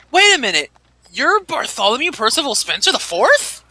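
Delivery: light up as it clicks who he is; excited recognition. Acted. NPC recognizes the famous actor.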